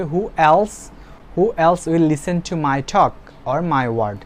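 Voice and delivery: very slow, every word separated for the class; slow model sentence. Shown as a demonstration. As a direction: read it out slowly like a dictation. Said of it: "teacher models the English sentence". (ধীরে ধীরে ক্লাসে পড়ে শোনানো)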